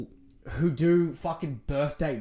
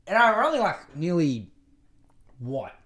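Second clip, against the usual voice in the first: ordinary delivery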